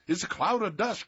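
put-on alarmed young man calling out; cried out. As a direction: call out at the dust cloud. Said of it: "cried one young man".

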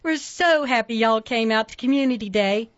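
put-on high, bright Southern-accented welcome; sugary Southern accent. A narrator's reading. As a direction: sweet-talk the crowd, Southern accent. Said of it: "in a sugary Southern accent".

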